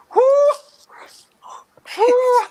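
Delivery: high, loud offended who; bristling at the little sister's jab; indignant who. Retold or imitated. To her teenage sister's sneer, an offended high shout.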